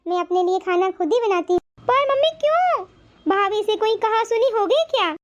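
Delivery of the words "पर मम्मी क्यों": high, startled "why, mom?"; shocked question. Acted. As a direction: blurt it out in disbelief. Daughter shocked mother cooks alone. (हैरानी से ऊँची आवाज़ में पूछना)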